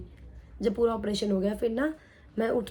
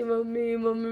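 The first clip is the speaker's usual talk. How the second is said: mock calling out in pain, held back; retold crying out; slower than usual. Patient imitating her calls for mother, mild retold cry. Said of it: "मैं बहुत तेज तेज चिल्ला रही थी".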